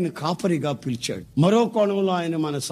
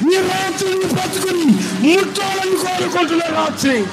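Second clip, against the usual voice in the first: impassioned preaching; 11 semitones higher than usual. Acted. Preacher to congregation at midnight service, fervent rising preaching. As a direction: preach it to the crowd, building.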